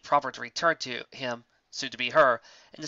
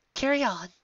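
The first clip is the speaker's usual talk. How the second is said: insisting; 11 semitones higher than usual. Acted. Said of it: "Lyra insisted".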